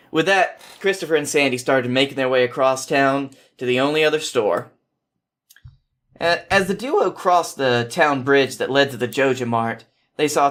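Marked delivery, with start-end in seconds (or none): none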